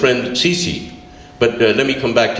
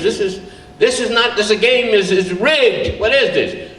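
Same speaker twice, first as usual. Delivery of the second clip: put-on high indignant outburst of a wronged boy; enraged kid; 9 semitones higher than usual. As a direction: sputter with childish outrage. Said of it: "suddenly enraged".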